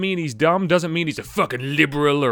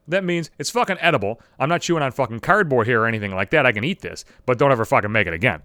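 normal talking voice

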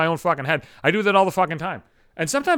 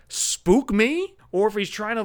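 ordinary delivery

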